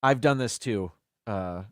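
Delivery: ordinary delivery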